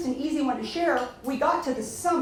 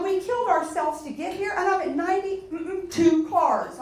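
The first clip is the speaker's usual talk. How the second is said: higher, slow, exasperated complaint; retold frustration; 7 semitones higher than usual. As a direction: replay the outrage of falling short, mildly. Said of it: "I was so mad that night".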